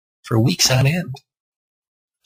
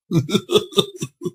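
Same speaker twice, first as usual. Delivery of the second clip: high breathy giggle; a secret little laugh in the closet; muffled snicker; 11 semitones higher than usual. Acted. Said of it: "you could hear him go".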